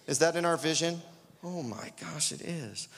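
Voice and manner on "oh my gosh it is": hushed, understated wonder; softly feigned surprise; mock amazement. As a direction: drop to a soft aside of pretend discovery. Feigned discovery about church vision.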